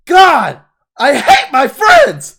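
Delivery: drawn-out, high despairing yell; Kevin. Retold or imitated. Man acting Kevin after Ruxin leaves, slow exasperated screaming. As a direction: bellow it slowly in utter exasperation. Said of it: "and he's like".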